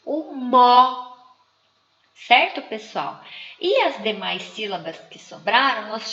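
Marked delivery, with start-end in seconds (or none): 0.0-1.1 "mó" loud and held long; stressed syllable MO. Shown as a demonstration. Teacher answers her own question, "mó" punched loud and drawn out. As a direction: hit "mó" hard and stretch it. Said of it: "qual é a sílaba que tem mais tonicidade?".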